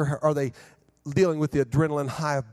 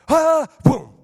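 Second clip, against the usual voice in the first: crash sound effect; 13 semitones higher, 11 dB louder than usual. Retold or imitated. Sugar crash.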